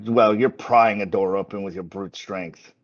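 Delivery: ordinary delivery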